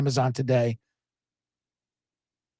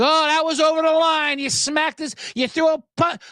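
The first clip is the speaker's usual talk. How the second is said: mocking scold; 7 semitones higher than usual.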